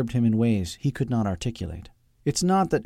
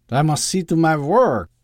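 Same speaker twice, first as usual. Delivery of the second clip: high, loud put-on foreign accent; thick Doctor Loeb accent; 7 semitones higher, 7 dB louder than usual. A narrator's reading. Doctor trying to escape his mother, thick accent. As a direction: excuse himself in a heavy accent. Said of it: "his Doctor Loeb performance".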